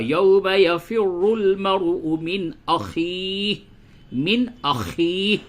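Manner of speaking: slow chanted tajweed recitation; Quran recitation. Acted. Teacher recites the verse slowly to students.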